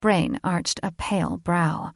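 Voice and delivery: ordinary delivery, nothing marked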